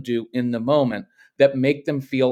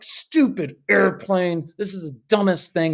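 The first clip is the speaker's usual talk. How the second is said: put-on frustrated kid; 4 semitones higher than usual. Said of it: "they're like".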